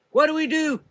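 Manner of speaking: rallying yell. A narrator's reading. Prudence rallying the sisters, yelling. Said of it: "Prudence yelled".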